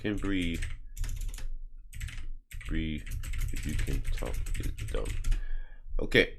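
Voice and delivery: a hint of reading off a phone, barely voiced; muttering to self. Real, not acted. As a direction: mumble the search terms under your breath. Muttering while typing a search.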